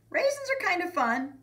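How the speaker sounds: put-on high, loud kid voice; thinking aloud. A narrator's reading. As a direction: talk yourself into it, high-pitched. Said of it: "he thought".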